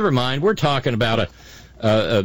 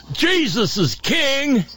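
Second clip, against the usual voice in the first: high, slow mocking chant; 13 semitones higher, slower than usual. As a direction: sneer it in a dopey high voice. Mocking a chud's T-shirt.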